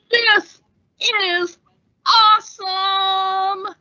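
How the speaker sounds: high, stretched joyous cry; thrilled. Acted. Pilot thrilled mid-flight, high joyous cry. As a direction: soar with delight.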